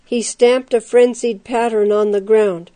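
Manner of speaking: ordinary delivery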